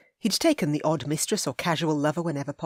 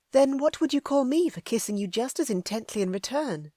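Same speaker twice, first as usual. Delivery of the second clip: put-on higher, lively young woman; young lady voice; 7 semitones higher than usual. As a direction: play the eager heroine.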